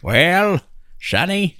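put-on high old-timer drawl; creaky old man calling sonny; grandpa voice. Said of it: "That's my grandpa impression".

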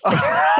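sudden squeaky laugh at himself; high burst of laughter. Real, not acted. Laughing at his own six-minute interview, high cackle. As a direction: crack up high at the punchline.

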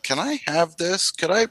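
high-pitched, eager imitation of a delighted friend; eager asking. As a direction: pitch it up, asking like a thrilled kid. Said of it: "He's like".

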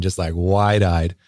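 normal talking voice

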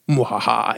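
low mock evil cackle; sneering panto villain laugh.

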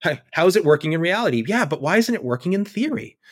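mock academic objection. Analyst mimicking theory-minded people arguing with reality, mock objection. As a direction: play a theorist querying what already works. Acts out practice versus theory exchange.

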